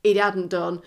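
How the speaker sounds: ordinary talk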